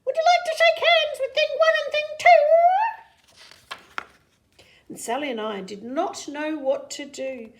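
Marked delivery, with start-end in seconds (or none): 0.1-2.4 high, loud squeaky creature voice; Thing voices. Acted. Things greet the children, high and squeaky. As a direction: chirp the greeting brightly. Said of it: "They said".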